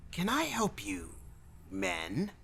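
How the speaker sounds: high, softish character voice; thin high greeting, lifting on the last word; weaselly clerk. Acted. Clerk looking up at two visitors, high and odd.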